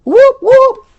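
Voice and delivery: high, loud whoop of celebration; whooping cheer. Real, not acted. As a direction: cheer it out like a hype man. Celebrating a free template.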